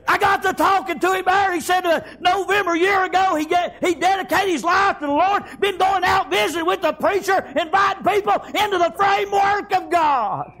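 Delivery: high, shouted testimony; full-force preaching. Real, not acted. Preacher tells congregation of a friend's changed life, full-force preaching.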